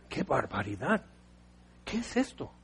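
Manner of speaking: very soft dismayed mutter; shocked Moses. Retold or imitated. Moses sees the weeping camp, hushed mock dismay. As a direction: breathe it out in disbelief.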